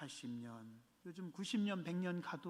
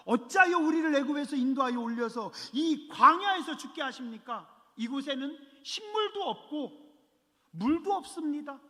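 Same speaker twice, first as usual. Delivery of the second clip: mock grumbling; 8 semitones higher, 4 dB louder than usual. Quoting Israelites complaining.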